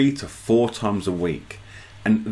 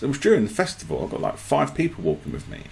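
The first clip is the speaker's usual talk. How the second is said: ordinary delivery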